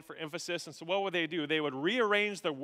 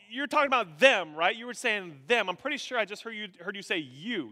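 higher, urgent mock protest; disbelieving protest; 4 semitones higher, 4 dB louder than usual. Original readers correcting Paul, disbelieving protest. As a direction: plead your case, sure there's been a mistake.

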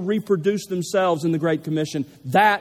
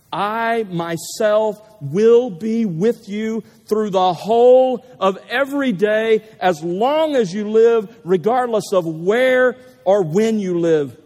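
slow, loud proclamation of a promise; emphatic preaching; 4 dB louder, slower than usual. Acted. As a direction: proclaim it slowly, hitting each key word louder.

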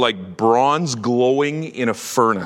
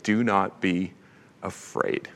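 soft, near-whispered reassurance; hushed comfort; 7 semitones lower, 8 dB quieter than usual. Retold or imitated. Jesus calming the fallen John, softly, almost whispered. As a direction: let it fall to a whisper, gentle.

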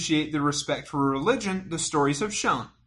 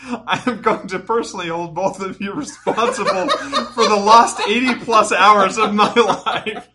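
loud, raised voice through laughter; joking accusation, building louder with laughter; laughing mock threat; 5 semitones higher, 9 dB louder than usual. Real, not acted. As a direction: read the threat out loud, cracking up. Jokey threat read out, both laughing.

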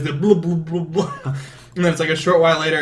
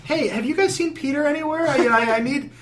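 mock Mary Jane; 11 semitones higher than usual. Mary Jane voiced in retelling.

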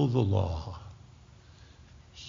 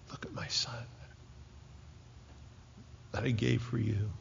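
softer whisper of God's words; hushed voice of God; 11 dB quieter, faster than usual. Acted. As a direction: let it fall to a whisper. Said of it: "God Almighty says".

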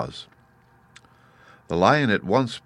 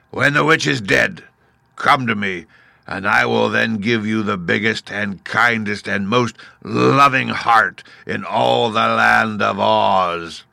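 slow, louder beast promise; booming beast; 3 semitones higher, 5 dB louder than usual. Acted. Beast Oz promising the Woodman a heart, booming. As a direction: boom the reward, drawn out.

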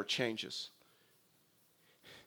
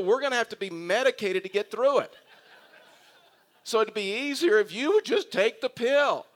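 louder, higher mock scolding breaking into laughter; retold angry doctor; 7 semitones higher, 6 dB louder than usual. The doctor scolding the nervous preacher, loud mock anger with laughs. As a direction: play the doctor's fake outrage, laughing through it. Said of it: "And he got angry".

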